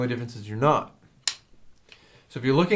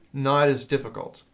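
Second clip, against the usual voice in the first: ordinary talk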